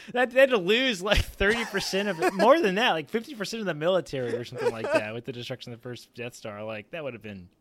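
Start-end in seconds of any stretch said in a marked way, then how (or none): 0.1-4.3 high and loud, getting carried away; rising disbelief at the losses; excited. Real, not acted. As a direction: blurt it out, amazed. Excited riff on Death Star losses.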